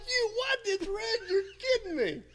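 very high, loud, incredulous squawk; outraged disbelief. Retold or imitated. Runner-up hearing the result called, acting out outraged disbelief. Retold outrage at losing first place.

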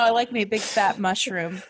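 usual speaking voice

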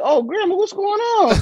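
high, innocent mock surprise.

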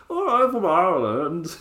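high, slow, badly faked Irish accent; mock a Dutch-tinged attempt at Irish; muddled Irish-Dutch accent. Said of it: "accent of a Dutch person who's lived in Ireland".

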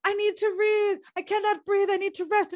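high-pitched mock breathlessness; hammed-up out-of-breath complaint; winded Nurse. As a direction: play an old nurse too puffed to talk. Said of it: "And she's like".

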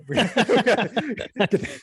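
high, laughing through the words. Real, not acted. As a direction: break up laughing mid-line. Cracks up mid-sentence.

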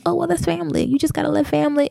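high, sing-song dismissive voice; mocking quote. Mocking the family excuse for her viewers in a high, sing-song voice. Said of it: "a lot of us would be like".

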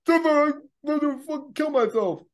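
high, slow whining recruit; whiny recruit voice. Acted. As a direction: wail it like a desperate recruit. Acting out suicidal recruit.

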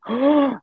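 loud, high acted gasp; gasp of realization. Son realizing how sisters felt, sharp imitated gasp. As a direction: gasp as if it suddenly sinks in. Acts child's dawning empathy.

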